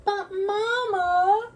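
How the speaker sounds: high, slow protesting cub voice; sputtering cubs. Acted. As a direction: whine it like a cub. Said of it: "sputtered the cubs".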